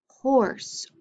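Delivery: normal talking voice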